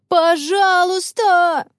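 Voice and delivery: high, drawn-out pleading cry; begging shout. A narrator's reading. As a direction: stretch it out like kids wailing together. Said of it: "закричали хором все четверо". (протяжный умоляющий крик)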